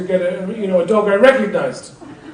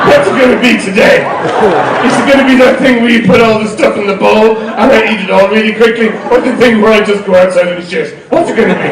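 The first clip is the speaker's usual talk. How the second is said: eager dog; 4 dB louder than usual. Retold or imitated. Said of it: "they come up to you going".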